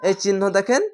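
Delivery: ordinary talk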